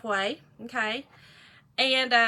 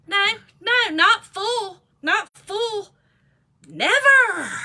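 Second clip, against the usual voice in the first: emphatic denial; 7 semitones higher, 6 dB louder, much slower than usual. Real, not acted. Emphatic denial while laughing.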